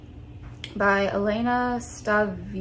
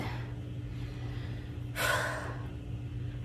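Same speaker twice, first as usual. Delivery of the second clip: heavy breathless sigh; 11 dB quieter than usual. Real, not acted. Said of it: "I gotta catch my breath".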